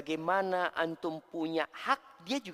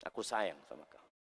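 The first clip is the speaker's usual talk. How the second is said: much lower, softer, dutiful mumble; flat loveless line; 11 semitones lower, 8 dB quieter than usual. Shown as a demonstration. Husband saying I love you to wife, lifeless low mumble. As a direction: say it like you don't mean it. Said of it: "Masa gitu ngomongnya?".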